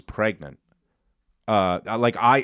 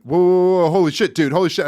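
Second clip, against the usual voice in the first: loud, startled whoa, a little higher; retold freak-out; 6 semitones higher, 8 dB louder, faster than usual. Rider in an Uber sees oncoming headlights, startled loud alarm. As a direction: yelp it like headlights are coming at you. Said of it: "I start freaking out".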